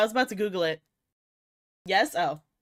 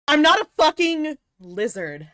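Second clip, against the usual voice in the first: loud, high indignant protest; mock offended outburst; mock outraged; 7 semitones higher, 10 dB louder than usual. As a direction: snap it back at chat. Indignant denial to chat.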